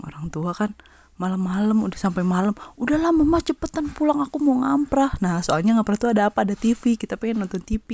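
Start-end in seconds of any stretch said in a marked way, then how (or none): 2.7-5.2 higher-pitched mock whine to mum; impatient pleading. Student urging visiting mother to go home, impatient plea. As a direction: hurry her along, imitating an impatient kid.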